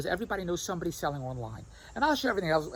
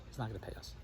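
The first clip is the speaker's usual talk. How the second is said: much softer, low muttered aside; muttered imitation; 8 semitones lower, 13 dB quieter, faster than usual. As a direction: mumble it dismissively under your breath. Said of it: "Andrea says".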